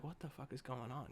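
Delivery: very soft, low suspicious murmur; hushed suspicion. Retold or imitated. Viewer doubting Keith, soft and wary. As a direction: breathe it out, wary. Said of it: "you're like".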